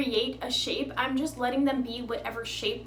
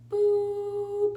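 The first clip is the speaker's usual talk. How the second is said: higher, playful boop; 7 semitones higher than usual. Real, not acted. Vlogger shows dabbing with a finger, playful boop. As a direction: pop the boop like a cute sound effect.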